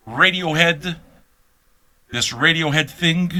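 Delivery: normal talking voice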